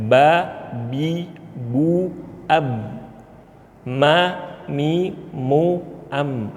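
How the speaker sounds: "Ba, bi, bu, ab, Ma, mi, mu, am" with lips strained and tensed; "Ba, bi, bu, ab, Ma, mi, mu, am" said with a grimacing mouth; wrong lip shape. Shown as a demonstration. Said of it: "Ini salah".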